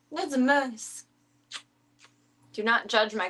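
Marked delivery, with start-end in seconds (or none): 0.1-1.1 cutesy voice. Pretend. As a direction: tease the dog fondly. Repeat in cutesy voice.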